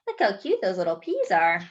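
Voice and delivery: usual speaking voice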